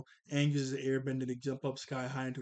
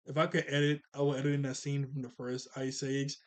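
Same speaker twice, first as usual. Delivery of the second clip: usual speaking voice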